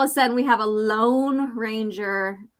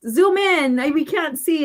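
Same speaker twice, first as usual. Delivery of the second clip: high, louder imitation of a viewer; mock complaint; 7 semitones higher, 5 dB louder than usual. Streamer mimics viewers asking to zoom in, mock complaint. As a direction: pipe up high and loud, mimicking a request. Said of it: "when you're like".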